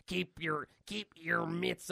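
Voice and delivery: slow, drawn-out hero warning. Acted. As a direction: stretch out a gruff warning.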